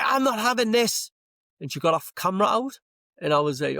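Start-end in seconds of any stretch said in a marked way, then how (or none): 0.0-1.1 much higher, louder put-on woman's voice; hammed-up busybody standing her ground; mock indignant woman. Woman refusing to let it go, in a mock high, louder indignant voice. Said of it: "And she was like".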